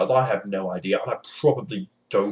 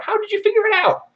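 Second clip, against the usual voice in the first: much higher, louder disbelieving cry; shocked imp; 7 dB louder than usual. Acted. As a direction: gasp out the question. Imp stunned.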